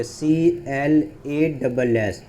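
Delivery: letters spelled out slowly, one by one; slow spelling out. Shown as a demonstration. Teacher spelling a word slowly for students. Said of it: "यही आप कहीं ऐसे देखे होंगे".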